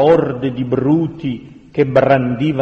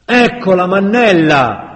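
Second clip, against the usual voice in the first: loud, higher exclamation; triumphant call; 6 semitones higher, 6 dB louder than usual. A narrator's reading. Bearded leader points her out to the mob, calling out. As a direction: announce the find to the crowd.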